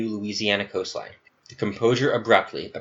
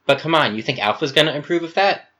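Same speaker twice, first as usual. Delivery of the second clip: higher, fast, skeptical push; incredulous doubt; 7 semitones higher, 5 dB louder, faster than usual. Acted. Gamma doubting Alpha will approve, higher and quick. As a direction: challenge him, you can't be serious.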